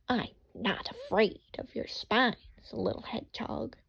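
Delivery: a sly whispered fox voice; sly fox whispering to the little hedgehog. Acted. Fox slinks over to Hedgehog.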